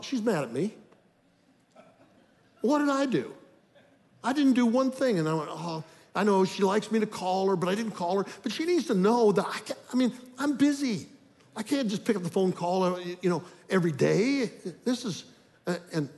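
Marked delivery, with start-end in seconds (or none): 0.0-5.2 higher, rising self-pity with a little laugh; indignant inner voice. Retold or imitated. Inner thought, feeling wronged. 5.5-12.8 higher, put-on whiny self-justification; defensive excuse. Self-justifying inner monologue.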